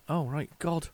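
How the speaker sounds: ordinary talk, nothing marked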